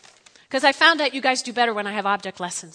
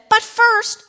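"But first" punched much higher and louder; emphatic stress; 11 semitones higher, 8 dB louder than usual. A narrator's reading. Elijah sets the widow a condition, "But first" stressed. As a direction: hit "But first" hard as the key words. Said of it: "underline that".

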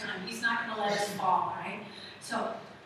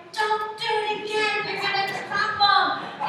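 high, loud, drawn-out mock protest; panicking mom; 10 semitones higher, 8 dB louder than usual. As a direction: wail it like a worried mother, hammed-up. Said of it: "And I am the mom".

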